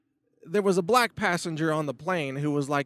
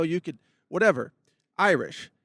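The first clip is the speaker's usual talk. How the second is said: ordinary talk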